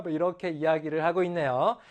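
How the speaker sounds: usual speaking voice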